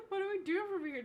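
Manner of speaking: high, half-laughing question; laugh-cry it out; laughing despair. Real, not acted. After computer crash, laughing.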